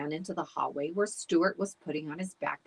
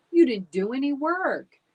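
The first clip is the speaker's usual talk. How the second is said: louder, higher scolding retort; 8 semitones higher, 9 dB louder than usual. A narrator's reading. Stuart scolding the dog for doing no work, louder. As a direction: call him out on it.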